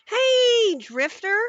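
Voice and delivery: loud high greeting to a viewer; calling out. Real, not acted. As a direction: shout the name out across the room.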